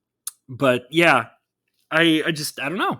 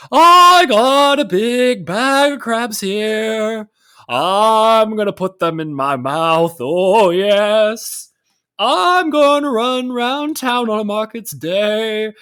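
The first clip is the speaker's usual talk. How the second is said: high, slow goofy character voice; silly cartoon voice; 6 semitones higher, 5 dB louder, slower than usual. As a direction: ham up a cartoon oddball. Imitating Bag of Crabs video.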